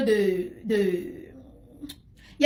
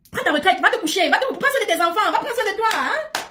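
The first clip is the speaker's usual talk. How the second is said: mocking dismissal; 8 semitones higher than usual. Real, not acted.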